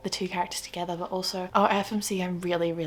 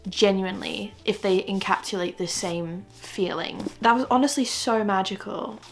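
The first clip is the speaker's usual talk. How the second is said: ordinary talk, nothing marked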